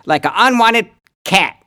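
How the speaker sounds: sustained high, loud mock tirade; hammed-up angry yell. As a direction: yell a threat in broad upstate accent. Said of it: "you yell at me".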